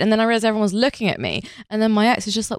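ordinary talk

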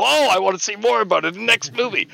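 high, eager fan voice; bright hooked viewer. Retold or imitated. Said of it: "and you go".

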